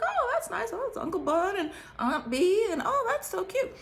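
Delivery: high, bubbly gush with little laughs; squealing sweet-talk over family pictures. Retold or imitated. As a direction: coo over the photos, delighted. Gushing over his family photos.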